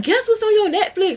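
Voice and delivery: high, teasing best friend voice; excited best friend. Retold or imitated. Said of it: "She talking about".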